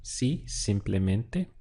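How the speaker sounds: Si simplemente spelled out slowly, syllable by syllable; Si simplemente slowly, with a rise on si; slow model phrase. Shown as a demonstration. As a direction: drag out Si simplemente so students can hear each part. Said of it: "what we'd say is".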